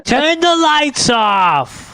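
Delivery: high, slow nagging mother; drawn-out mom complaint. Retold or imitated. Imitating mom complaining about the lights, high and slow. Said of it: "Mom GPT".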